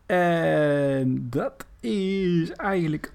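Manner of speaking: very slow, stretched-out speech; slow motion. Real, not acted. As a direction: drag every word out slowly. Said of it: "ik doe nu heel langzaam praten".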